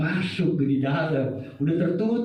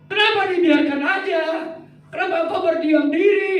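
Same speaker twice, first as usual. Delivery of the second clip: much higher and louder; angry complaint; 11 semitones higher, 5 dB louder than usual. Acted. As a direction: accuse God of staying silent. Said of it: "Habakuk marah". (Habakuk menegur Tuhan yang diam, keras dan tinggi)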